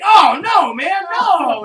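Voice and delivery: loud, high, disgusted cry; grossed-out shout. Retold or imitated. Dee and Mac reacting to the guy's daughter, a loud disgusted shout. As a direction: recoil at the creepy guy. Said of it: "they're just like".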